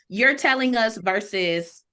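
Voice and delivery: ordinary talk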